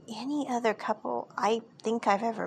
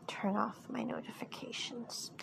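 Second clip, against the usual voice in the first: embarrassed whispered aside; whispering; 12 dB quieter than usual. Real, not acted. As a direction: whisper it under your breath. Embarrassed aside about phone notifications.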